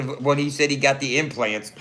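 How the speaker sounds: ordinary talk, nothing marked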